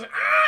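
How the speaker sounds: high, loud mock scream; sudden hammed-up shriek; startled scream. Droid hears his friends' danger, mock scream. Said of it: "he goes".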